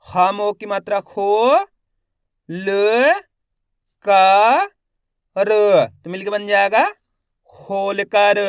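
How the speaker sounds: slow spelling drill, each syllable held with a rising end; stretched syllables for beginning readers; letter-by-letter sounding out. Shown as a demonstration. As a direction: sound out each letter, then blend the word. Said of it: "एक एक अक्षर का उच्चारण".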